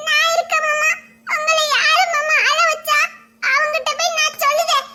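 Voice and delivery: put-on high, childlike protective voice; childlike promise. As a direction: promise to fight for him like a small child. Comforting uncle in childlike manner. (மாமாவுக்காக சண்டை போடும் குழந்தைக் குரல்)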